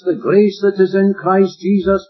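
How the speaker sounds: normal talking voice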